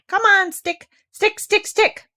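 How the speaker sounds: high, loud cheering chant; calling out. A narrator's reading. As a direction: cheer the stick on, bouncing with excitement. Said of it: "calling out".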